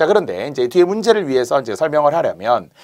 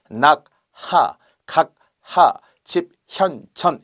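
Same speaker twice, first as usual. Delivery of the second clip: 낙하, 각하, 집현전 very slowly, each syllable on its own; staccato syllables; 2 semitones higher, much slower than usual. Shown as a demonstration. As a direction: break 낙하, 각하, 집현전 apart so every h is heard. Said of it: "스타카토처럼".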